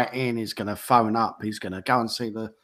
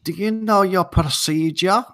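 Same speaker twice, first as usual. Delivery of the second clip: put-on Eastern European accent; 8 semitones higher, 4 dB louder than usual. Train guard quizzing a passenger, mock Eastern European accent. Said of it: "he's got this Eastern European accent".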